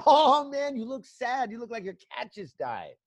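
high, mock pleading voice; voicing his eyes. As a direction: give his watering eyes a high little voice. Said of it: "his eyes going".

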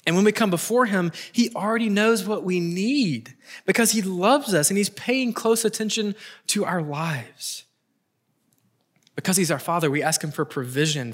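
ordinary talk

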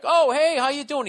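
high, loud, fake-cheery hello; over-friendly greeting. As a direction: ham up a phony friendly greeting to win him over. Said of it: "trying to be over friendly".

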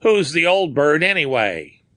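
plain reading voice

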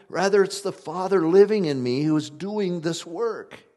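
loud, high, driving sermon voice; preaching at full force. Acted. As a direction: build the Gospel up to the rafters. Preaching scripture to congregation.

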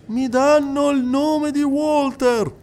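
high, insistent clairvoyant addressing the hall; a medium coaxing a silent widow; medium's voice. Acted. Medium speaking to the crowded séance hall, high and insistent.

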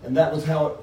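reading a novel aloud to the group; steady read-aloud with the characters lightly voiced; story reading. To the book group, reading the chapter aloud.

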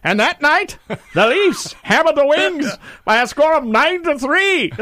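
high, 1940s radio announcer; old-timey radio voice. Acted. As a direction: crank up an old newsreel broadcaster. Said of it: "Doctor J loves my old-timey radio voice".

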